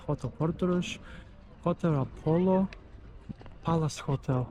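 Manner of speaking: ordinary talk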